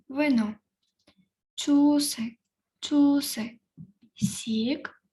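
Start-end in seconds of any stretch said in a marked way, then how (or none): 1.6-3.6 Чуси louder with a falling tune; Korean pronunciation. Shown as a demonstration. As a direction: model Чуси. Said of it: "я прочитаю по декілька разів слово". («Чуси» голосніше, чітко)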